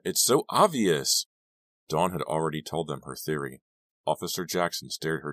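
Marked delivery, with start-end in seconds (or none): 0.0-1.3 higher, louder, drawn-out insistence; snapped. A narrator's reading. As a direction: snap it slowly and emphatically. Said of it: "snapped Dawn".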